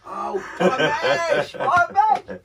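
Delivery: slow drawn-out "Oh", pitch shooting up on the second "mash"; a sudden penny-drop exclaim; excited realisation. Retold or imitated. Realising what his northern friend meant, stretched and rising. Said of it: "I went".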